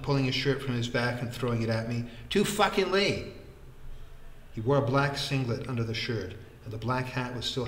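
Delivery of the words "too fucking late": high, emphatic bellow. A narrator's reading. Vikram throws the shirt at his friend, bellowing. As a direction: hurl the words out. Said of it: "he bellowed".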